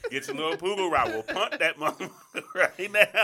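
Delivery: loud, high comic rant through laughter; mock threat. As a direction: bellow a joking threat, cracking up. Joking threat about being in pain.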